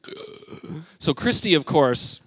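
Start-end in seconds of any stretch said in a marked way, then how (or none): 0.1-0.8 whispered groan. Retold or imitated. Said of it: "we were just like".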